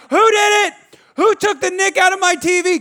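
high, loud outraged yell; full-voiced accusing shout at the kids. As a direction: re-enact a furious parent yelling. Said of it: "I'm shouting".

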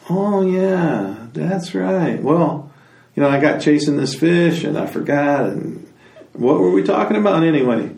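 high-pitched, dopey dawning realization; forgetful Otter. Acted. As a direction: play a scatterbrained otter making excuses. Otter remembers, excuses his forgetting.

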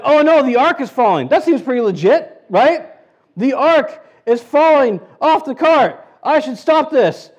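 hammed-up alarm at the falling ark; eager Uzzah. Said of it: "he's like".

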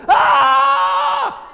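high, loud panicked yell; panicked scream. Retold or imitated. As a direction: scream like a fireman losing his nerve. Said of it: "and go".

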